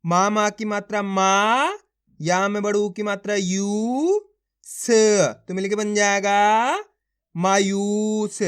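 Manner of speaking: slow, stretched reading of each letter; slow sounding out. Shown as a demonstration. Teacher demonstrating for pupils, slowly and deliberately. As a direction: spell it out slowly for the class. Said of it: "देखो किस तरीके से है".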